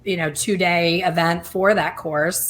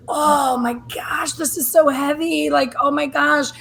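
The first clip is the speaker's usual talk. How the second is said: imitating crying; 9 semitones higher than usual. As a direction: retell it as an overwhelmed, weepy wail. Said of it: "she was crying at one point".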